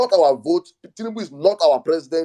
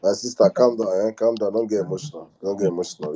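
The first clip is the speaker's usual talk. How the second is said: soothing calm down; 6 semitones lower than usual. Real, not acted. Host calming an upset caller, low and soothing. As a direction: settle her down gently, voice dropped low.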